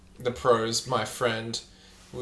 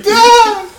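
sudden high, loud fake "Ah!" laugh burst; 18 dB louder than usual. Shown as a demonstration. Showing his cohost his new fake laugh, a sudden high yelp. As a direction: blurt a goofy put-on laugh out of nowhere. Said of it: "That's my new fake laugh".

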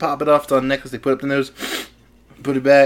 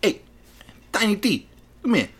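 high-pitched New Jersey wise guy; New Jersey mobster; 10 semitones higher than usual. Acted. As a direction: summon him in a New Jersey accent. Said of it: "stereotypical, like, New Jersey's".

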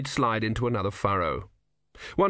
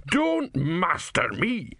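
put-on slow, higher, hurt old man; bitter old philosopher; 6 semitones higher, much slower than usual. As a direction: draw it out in bitter reproach. Didactylos rebukes Ern.

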